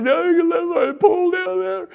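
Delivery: put-on boy pleading with the guard about his pole; little boy. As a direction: squeak it like a small kid.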